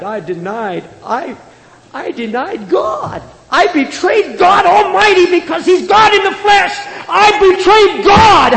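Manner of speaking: high anguished wail, breaking into a shout; Peter's anguished cry. Acted. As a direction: cry out your guilt as Peter. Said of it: "Peter walking the hills weeping".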